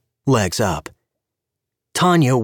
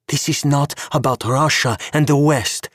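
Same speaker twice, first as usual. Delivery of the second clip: hissed; 6 semitones higher than usual. A narrator's reading. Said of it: "she hissed".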